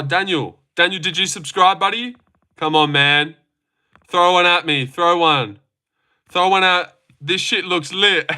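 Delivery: ordinary talk, nothing marked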